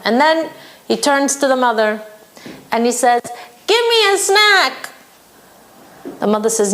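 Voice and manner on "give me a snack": put-on a kid demanding a treat; demanding child. Six-year-old whining to his mother for a snack.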